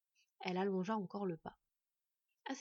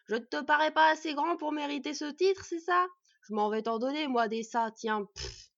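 loud, raised rant ending in a huff; outraged; 6 semitones higher, 9 dB louder than usual. A narrator's reading. Dwarf to the boy who doubted his title, loud indignation. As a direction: let wounded pride burst out, then puff. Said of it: "s'étrangla un Nuri outré".

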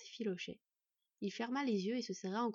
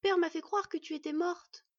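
high, loud upset outburst; distressed protest; 8 semitones higher, 6 dB louder than usual. A narrator's reading. Boy confronting the mother he thought dead, distressed. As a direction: blurt it out, hurt and high.

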